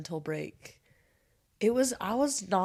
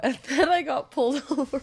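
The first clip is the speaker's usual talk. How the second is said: laughing through the words; 7 semitones higher, 6 dB louder than usual. Real, not acted. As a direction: giggle it out as you tell it. Laughing while retelling mishap.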